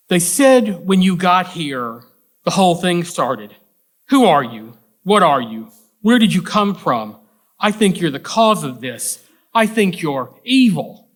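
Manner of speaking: loud, emphatic accusation, mildly hysterical; hysterical mother. Acted. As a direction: read it as a frightened mother pointing blame, lightly acted. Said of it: "The hysterical mother in the diner".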